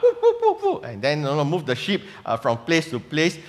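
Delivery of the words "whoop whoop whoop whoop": high-pitched whooping dog barks; sheepdog bark. Retold or imitated. Preacher mimics sheepdogs herding the flock, high whooping bark. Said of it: "the sheepdogs will go and bark".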